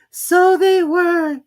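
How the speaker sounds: high and very slow; drawn-out squeaky mouse; Dormouse voice. Acted. Dormouse answers.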